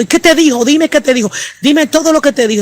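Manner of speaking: put-on an anxious grandma insisting the child tell all; urgent demand.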